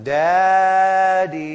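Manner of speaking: put-on sing-song kid's call for a parent; child calling out. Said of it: "getting up in the night and going".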